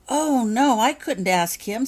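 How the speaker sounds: loud, high, slow panicked protest. A narrator's reading. Becky panics at asking Hagrid, loud and high. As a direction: recoil in fright. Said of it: "said Becky in a panic".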